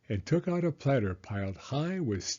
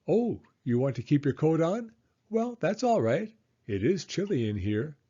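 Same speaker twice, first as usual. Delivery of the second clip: put-on higher, bright old grandmother voice; Baba Brena; 5 semitones higher than usual. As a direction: play the kindly old hostess, pitched up. Baba Brena chatting to the bear.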